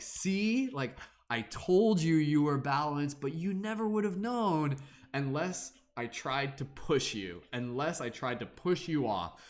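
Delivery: knowing universe. Acted. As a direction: savor it like a smug mentor. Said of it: "the universe is like".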